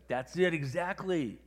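loud, high and drawn out; excited agreement. Retold or imitated. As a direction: act out someone suddenly won over. Retold actor agrees excitedly.

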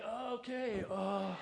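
high, drawn-out mock-stunned okay; hammed-up dad at a total loss; flustered dad. Dad holding child's vomit, acted.

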